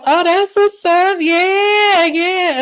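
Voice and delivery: high hammed-up forced excitement; mock forced delight. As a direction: fake being pleased, hammed up. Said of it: "She goes".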